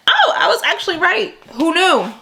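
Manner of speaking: high, surprised and pleased; pleased surprise. Real, not acted. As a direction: squeal at being proven right. Guess about book proved right.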